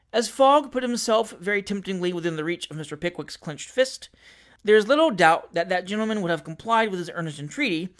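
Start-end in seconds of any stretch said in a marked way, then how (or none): none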